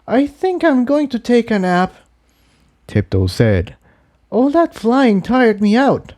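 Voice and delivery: high, tender confession; lightly voiced mare, sincere; voiced mare confessing. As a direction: Tiptoe confessing love to Jigsaw. Character voice.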